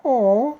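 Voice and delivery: touched aw. Retold or imitated. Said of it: "And she was like".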